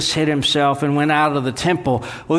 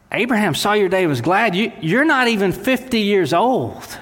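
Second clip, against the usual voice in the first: scornful ridicule climbing higher; mocking; 6 semitones higher than usual. Pharisees jeer at Jesus for claiming to know Abraham, mocking. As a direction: sneer it, rising to a jeering question. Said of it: "They're mocking him".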